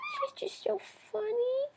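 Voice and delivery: high cooing repeat; pet talk. Real, not acted. Owner cooing to the bird about her, high voice.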